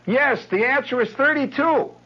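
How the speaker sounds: put-on very high, loud girlish snap; curt Patty. Girl curtly calls out the homework answer. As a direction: answer and shut the door on him.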